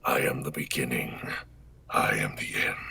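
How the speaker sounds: low whispered menace; Omen voice line. Acted. Repeating the line for chat on camera, low whisper. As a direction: whisper it from the dark.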